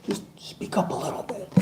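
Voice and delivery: whispered, barely voiced plea; soft-spoken wife. Imitating his soft-spoken wife asking him quietly, whispered. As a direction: mimic the wife's tiny whisper. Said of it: "she's very soft-spoken".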